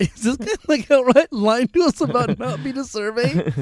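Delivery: high, slow outraged disbelief; can't believe he's lying to us; mock-stunned inner voice; incredulous. Indignant inner voice, retold.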